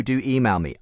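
usual speaking voice

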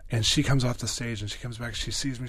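usual speaking voice

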